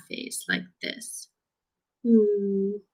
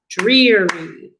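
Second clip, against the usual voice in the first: DREE-ree split into two even claps; clapped out by syllables. Shown as a demonstration. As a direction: say Dreary slowly, one clap per syllable. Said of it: "Let's clap it out".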